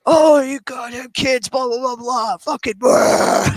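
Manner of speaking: high, slow, drawn-out ranting growl; furious rant dissolving into nonsense, hammed up; mock rage. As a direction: imitate a raging gamer cursing kids. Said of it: "Rage, man, rage".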